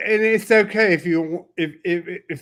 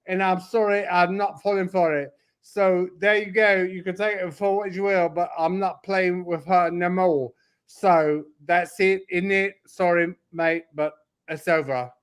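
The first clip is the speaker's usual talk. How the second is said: mock Cockney rant. Cockney impression for laughs, hammed-up London accent. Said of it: "I'm switching to Cockney".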